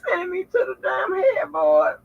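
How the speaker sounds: high crying wail; mock sob. Complaining to her friend, high mock crying wail. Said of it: "I said".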